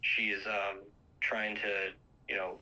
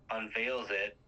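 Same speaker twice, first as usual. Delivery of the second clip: ordinary talk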